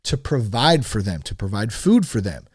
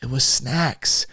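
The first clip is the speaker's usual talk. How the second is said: usual speaking voice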